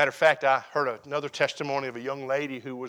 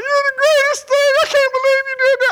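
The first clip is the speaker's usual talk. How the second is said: very high, loud, thrilled squeal; wife's ecstatic squeal; 12 dB louder than usual. Retold or imitated. Wife thanking husband for mailbox letters, ecstatic high squeal. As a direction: gush with delight, way up high. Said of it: "She come running the house".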